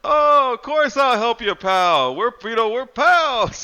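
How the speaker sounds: very high, loud, sing-song greeting; cheery Elmer voice. Retold or imitated. Elmer greeting the dying Brian, squeaky and cheery. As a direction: chirp it like an oblivious cartoon pal. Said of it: "he's like".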